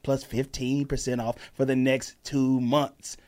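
slow, spelling out every code; slow emphatic ad read. Shown as a demonstration. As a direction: drill the code into them slowly. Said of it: "Do you understand what I said?".

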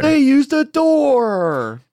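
very high, slow, loud gasp of wonder; milk the fake revelation; hammed-up amazement. Echoing friend's joke about the ad, hammed-up high slow wonder.

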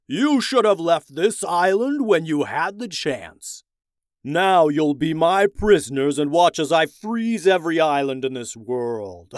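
loud, booming villain king voice; roaring tyrant king. Acted. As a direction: gloat and threaten like a tyrant. Ice King threatens the prisoners.

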